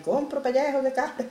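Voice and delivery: street vendor call. Acted. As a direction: call your wares down the street. Vendedor pregona por la calle. (pregón de vendedor ambulante)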